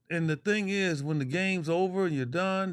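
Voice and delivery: ordinary delivery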